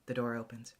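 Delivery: ordinary delivery, nothing marked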